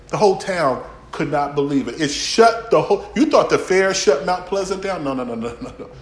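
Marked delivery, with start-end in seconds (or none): none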